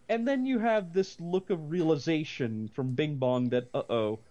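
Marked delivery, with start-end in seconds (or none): none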